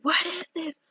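high, breathy half-whispered panic, retold; retold terrified cry. Recalling the demon at her bed, a frightened high whisper. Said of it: "I'm just saying".

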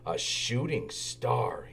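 slow, low mock-mysterious tease; big engine teasing little Thomas, slow and low. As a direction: draw it out, setting up a joke. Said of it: "decided to play a joke".

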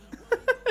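short high laugh of delight; a quick excited giggle; high giddy laugh. Real, not acted. About the Charizard, a short high giddy laugh.

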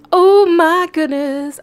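loud, high and slow, stretched out; slow squeal of delight; drawn-out excitement. Real, not acted. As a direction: savour each word, thrilled. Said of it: "I am so excited!".

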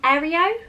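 AEREO said with an extra "ee" before the final O, rising; alternative pronunciation. Shown as a demonstration. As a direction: question the word Aereo, unsure how it is said. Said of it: "Is that how you say it?".

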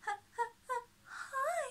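high, tearful stammer, stuck on P; a shy girl fighting to get her name out. A narrator's reading. Penny stammers hello to the twins, high and tearful. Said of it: "she stammers".

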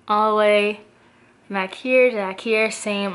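lip corner held open; talking while showing braces; finger pulling lip. Real, not acted. Seen 0: Finger hooks mouth corner, pulling lip back to show braces.

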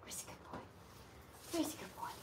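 soft whispered pet talk; whisper to dog. Real, not acted. Groomer whispering baby talk to the dog. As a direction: hush it to the dog.

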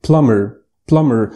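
بلمر said "PLUM-er", silent b, English r; بلمر without the b; correct English pronunciation. Shown as a demonstration. Teacher modelling plumber twice. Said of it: "بلمر مش بلمبر".

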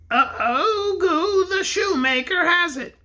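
high, slow toy bear voice; the pink bear answers the king; small shrill voice. Acted.

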